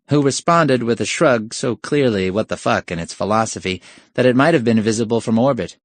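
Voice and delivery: ordinary delivery, nothing marked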